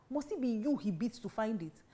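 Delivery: usual speaking voice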